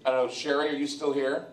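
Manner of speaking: normal talking voice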